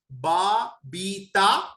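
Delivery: slow बाबीता; sounding out बाबीता. Shown as a demonstration. Teacher shows the good sound in बाबीता. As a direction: stretch बाबीता from बा. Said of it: "तो बा अच्छा है".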